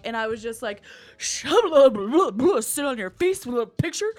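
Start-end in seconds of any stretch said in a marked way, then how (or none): 1.2-4.1 loud, high garbled gibberish; blubbering idiot. Retold or imitated. Woman meeting her crush, hammed-up blubbering. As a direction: blurt it out in a flustered, swooping babble. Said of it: "a fucking blubbering idiot".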